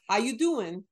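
casual New Jersey greeting; New Jersey accent. Pretend. Showing how people talk back home, New Jersey accent. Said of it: "I'm from New Jersey and New York".

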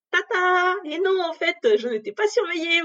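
high, loud sing-song ta-da; ironic reveal. Real, not acted. Revealing to her friend that nobody was monitoring her, high and sing-song. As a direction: announce the twist with mock fanfare.

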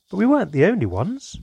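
loud, high, eager blurt; blurting loudly. A narrator's reading. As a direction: burst out before anyone stops you. Child blurts out.

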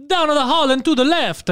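hammed-up Italian accent; fake Italian accent. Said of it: "but with fake Italian accents".

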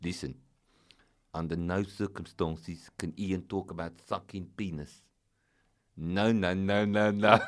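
low, firm South African agent; South African accent. Acted. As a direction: forbid it sternly in a put-on South African accent. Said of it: "he's like".

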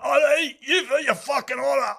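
put-on Edinburgh junkie accent. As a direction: shout it like a heroin addict in Edinburgh. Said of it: "how similar those accents were".

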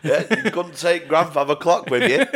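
high and loud, laughing through it. Real, not acted. Riffing on the co-host's joke, laughing. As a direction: giggle while riffing on the joke.

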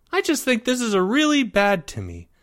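put-on high-pitched kid voice; wary, pitched well up; doubtful young boy. A narrator's reading. Boy cautioning his lovestruck friend, high and doubtful. Timmy objects.

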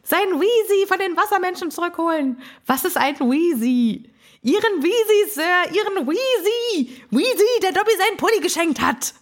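very high, earnest house-elf voice; Dobby. Retold or imitated. House-elf explains to baffled Harry, high and urgent. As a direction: plead in a piping elf voice. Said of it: "Dobby weiß es sehr wohl".

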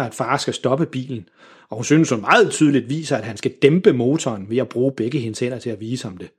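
usual speaking voice

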